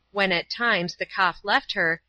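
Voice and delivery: usual speaking voice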